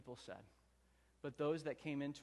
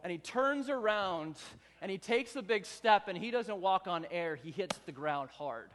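normal talking voice

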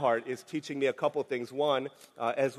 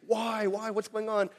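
anxious why; 6 semitones higher than usual. Pretend. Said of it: "instead of asking".

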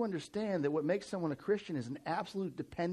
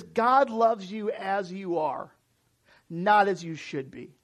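slow sincere reading; 3 semitones higher, 6 dB louder, slower than usual. Real, not acted. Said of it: "It says".

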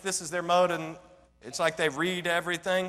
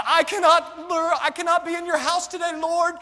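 high, loud, overwrought begging to the Lord; hammed-up emotional outburst, pitched way up; mock emotional plea; 8 semitones higher, 5 dB louder than usual. Football fan excusing himself to God from church, mock emotional pleading. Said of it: "some of you will get emotional".

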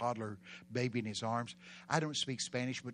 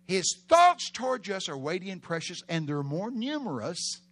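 loud preaching; 7 semitones higher, 9 dB louder than usual. Real, not acted. Preached verse louder.

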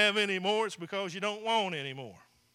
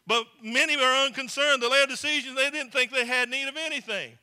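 raised, louder preaching voice that drives each line home; preaching at full force; 5 dB louder than usual. Acted. Preacher exhorting his congregation in a raised, louder voice. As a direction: preach it out to the whole room.

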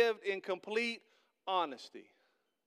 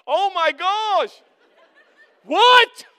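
much higher, much louder, slowed, laughing disbelief; shocked wife; 10 semitones higher, 17 dB louder, much slower than usual. Retold or imitated. Wife Patty reacting to his confession, laughing high shocked squeal.

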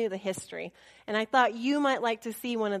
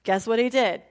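ordinary delivery

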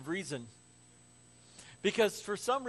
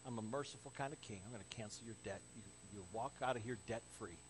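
much softer and lower; merciful king; 8 semitones lower, 11 dB quieter, faster than usual. Acted. King to debtor cancelling the debt, gently merciful. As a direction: grant pardon in a hushed voice.

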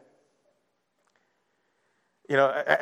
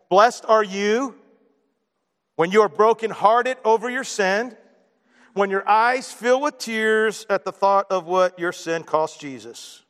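slow, deliberate; 2 semitones higher, slower than usual. Acted. Slow emphatic beatitude.

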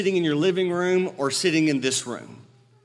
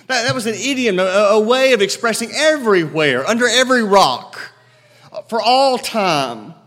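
loud, high preacher's call; preaching at full force; 4 semitones higher, 6 dB louder than usual. Acted. Preacher to his congregation, loud and ringing. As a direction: proclaim it to the whole room.

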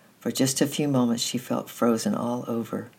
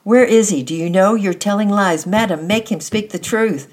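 high, loud, furious shouting; furious screaming; 9 semitones higher, 10 dB louder than usual. A narrator's reading. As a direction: scream at him, shaking him. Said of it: "Annette screamed back".

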